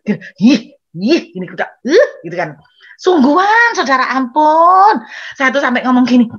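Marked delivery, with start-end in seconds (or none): 0.4-1.2 short mock squeals of irritation; pent-up "ih" of frustration; exasperated grunt. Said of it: "Dari sabar sampai pegel saya". 1.9-2.1 rising grunt of mock frustration; pent-up, exasperated "uh"; exasperated growl. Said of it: "Dari sabar sampai pegel saya". 3.0-5.0 loud, high, drawn-out plea; loud, overwhelmed wail for mercy; exasperated outcry. Acted. Comic exasperation at the child.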